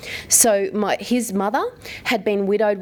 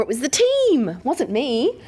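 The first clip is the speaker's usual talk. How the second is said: higher, a touch brighter, brushing off praise; modest deflection; 5 semitones higher, slower than usual. Retold or imitated. To the audience, mimicking girls deflecting praise, higher and modest. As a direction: toss off the credit with mild modesty.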